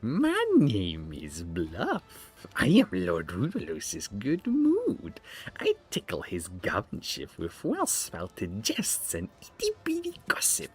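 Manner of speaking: high, slow, whispery court jester; a sneering fool, squeaked and breathy; very high sly whispering jester; Lemmy voice. Acted. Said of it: "I'll have to give him a Lemmy voice".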